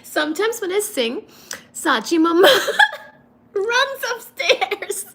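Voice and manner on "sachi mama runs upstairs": high voice breaking into laughter; real amused laugh mid-sentence. Real, not acted. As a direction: get it out through giggles. Cracks up telling about her singing.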